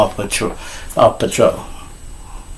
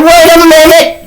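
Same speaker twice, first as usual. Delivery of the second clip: high, loud screech to halt; commanding shout; 6 dB louder than usual. Acted. Miss Washington halting the departing kids, shrill shout. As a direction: stop them dead with a piercing call.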